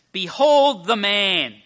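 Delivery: slow, high, booming announcement; loud proclamation. Acted. Pilate presenting Jesus to the people, loud proclamation. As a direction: proclaim it to a crowd, stretching each word.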